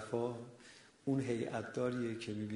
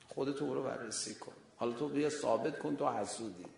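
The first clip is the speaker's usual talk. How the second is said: ordinary talk, nothing marked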